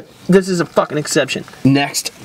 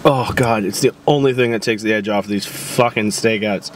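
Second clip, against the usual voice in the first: ordinary delivery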